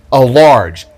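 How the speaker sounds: usual speaking voice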